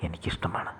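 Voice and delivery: soft, breathy confession under the breath; whispered. A narrator's reading. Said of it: "അവൾ മന്ത്രിച്ചു". (മന്ത്രിക്കുന്ന സ്വരത്തിൽ)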